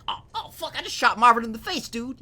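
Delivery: panicked movie gangster, pitched high; John Travolta impression. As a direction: freak out like a hitman who just fired by accident. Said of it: "the Pulp Fiction John Travolta".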